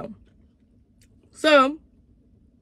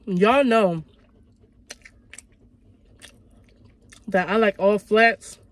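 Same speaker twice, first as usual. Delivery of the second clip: eating chicken wing between words; talking while chewing; 4 dB louder than usual. Real, not acted. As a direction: mouth partly full of food. Seen 1: Bit wing at -7; chewing, licking fingers, holding wing throughout.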